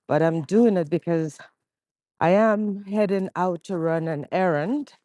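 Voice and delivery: normal talking voice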